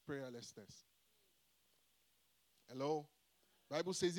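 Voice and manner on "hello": ordinary delivery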